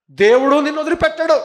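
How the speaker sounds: high, loud preacher's warning. Acted. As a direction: hurl the warning out.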